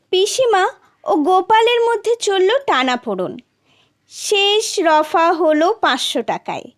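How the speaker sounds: plain reading voice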